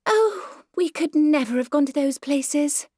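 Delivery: fluttery society mother, high and loud; vague Myrtle voice. Acted. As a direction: brush the question off like a flighty lady.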